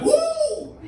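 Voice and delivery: loud high whoop of a cheering crowd; mock Irish woo. Comic mocking a crowd's cheer with a whoop. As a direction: whoop like a pub full of Irish fans. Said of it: "it's like".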